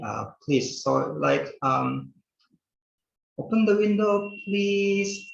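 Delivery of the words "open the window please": gentle polite request. Shown as a demonstration. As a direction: ask kindly, not ordering. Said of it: "Lebih, lebih lembut dibandingkan dengan".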